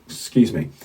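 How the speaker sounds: usual speaking voice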